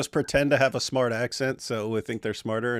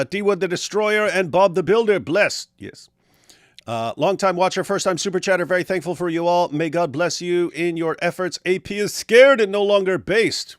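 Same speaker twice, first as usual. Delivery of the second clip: higher-pitched announcer-like reading; super chat read-out; 6 semitones higher, 4 dB louder than usual. Acted. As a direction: read the messages out with showy emphasis. Reads super chats aloud.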